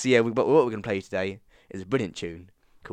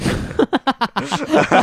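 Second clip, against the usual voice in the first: loud laugh; 8 dB louder than usual. Real, not acted. Laughing at own mischief.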